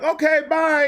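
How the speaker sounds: put-on high, quick girly goodbye; girl voice. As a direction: chirp it like her. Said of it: "She was like".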